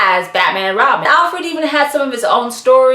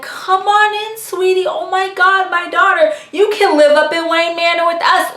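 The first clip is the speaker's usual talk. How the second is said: higher, gushing fatherly welcome; Alfred gushing; 8 semitones higher than usual. Retold or imitated. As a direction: play Alfred overjoyed at meeting his daughter. Voicing Alfred welcoming his daughter.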